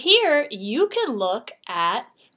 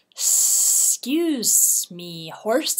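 very slow, sliding snake voice; sly snake; much slower than usual. Acted. Snake slips past Horse, slow and sly. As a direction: stretch it out like a snake slipping past. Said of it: "said Snake as she slid".

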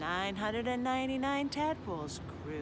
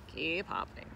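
low, softer father voice; father frog; 5 semitones lower, 5 dB quieter than usual. A narrator's reading. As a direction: urge them on low and quiet. Father frog urges children on.

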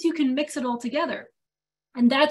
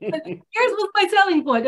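higher-pitched imitation of a Greek businessman; Greek salesman; 7 semitones higher, 4 dB louder than usual. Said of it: "not supposed to do a Greek accent".

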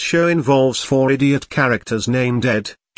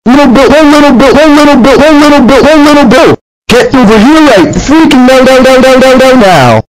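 high, loud, furious calling; furious shout; 13 semitones higher, 15 dB louder, faster than usual. Acted. Parent shouting for the busted kid, furious high yell. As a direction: scream for the kid to come now.

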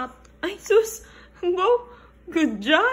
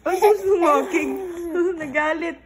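laughing hard; 5 semitones higher, 5 dB louder, much faster than usual. Real, not acted. Mom laughs at upset baby.

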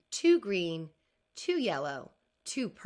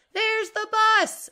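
loud, high, drawn-out call; 14 semitones higher, 10 dB louder, slower than usual. Acted. Mom calling out to Jessica that the bus arrived. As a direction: hurry them, the bus is here.

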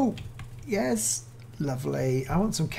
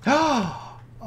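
sharp, high gasp of delight; 10 semitones higher than usual. Real, not acted. YouTuber spotting a new rabbit, delighted gasp. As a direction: gasp at how cute they are.

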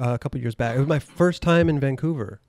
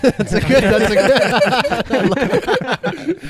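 laughing; 10 semitones higher, 4 dB louder, much slower than usual. Real, not acted. Host laughing at guest joke.

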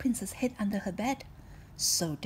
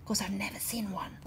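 whisper. Acted. Gilbert voiced.

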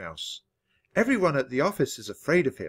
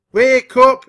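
high, very loud shriek; 11 semitones higher, 15 dB louder than usual. A narrator's reading. A caller wakes the sleeping mouse, shrieking. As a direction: shriek it down the phone as an alarm. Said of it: "voice shrieked back at me".

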